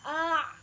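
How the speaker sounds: A pushed from the throat, loud; loud demo A with ain. Shown as a demonstration. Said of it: "Nah, gitu ya".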